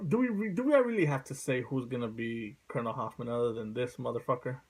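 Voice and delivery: ordinary talk, nothing marked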